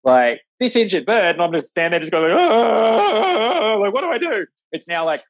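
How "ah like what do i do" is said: high, loud panicked wail; mock panic. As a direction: imitate a helpless beginner panicking. Acts out her panicked novice self.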